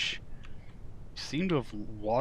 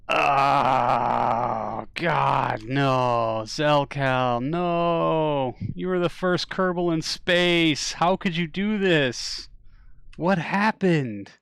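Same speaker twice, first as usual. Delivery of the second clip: loud, drawn-out lament, climbing higher; mock-grieving wail; 4 semitones higher, 9 dB louder, much slower than usual. Player lamenting the first Kerbal in space, hammed-up grieving wail. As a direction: wail theatrically over a lost hero.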